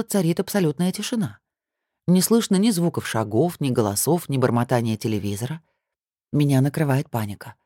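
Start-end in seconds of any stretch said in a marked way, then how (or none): none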